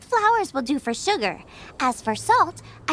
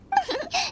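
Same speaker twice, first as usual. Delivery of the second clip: bright giggle; 9 semitones higher, 4 dB quieter than usual. Acted. Asked for the recipe.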